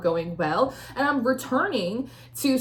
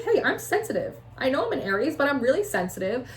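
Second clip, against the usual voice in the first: high-pitched, mildly protesting aside; pleading self-defense; 3 semitones higher than usual. Acted. Tarot reader voicing the querent, high mock plea. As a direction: voice someone owning up to being sensitive.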